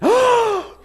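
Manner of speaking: huge, high theatrical gasp; hammed-up gasp of recognition; star-struck gasp. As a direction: gasp loudly in mock amazement. Star-struck actor turns round.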